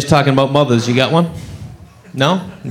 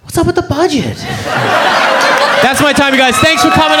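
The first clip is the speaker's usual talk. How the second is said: punchline; 9 semitones higher than usual. Retold or imitated. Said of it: "And I was like".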